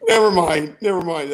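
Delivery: said loud through laughter; embarrassed laughter after a flop; laughing it off. Real, not acted. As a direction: wave the joke away while still laughing. Laughing off the bad joke.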